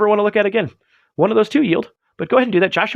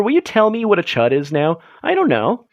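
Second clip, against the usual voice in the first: ordinary talk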